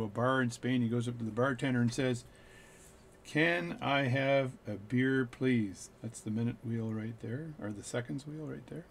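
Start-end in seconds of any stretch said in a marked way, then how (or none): 3.2-5.9 put-on slow Newfoundland accent, every word drawn out; speaking really slow. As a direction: stretch out each word like a tourist. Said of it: "speak really slow".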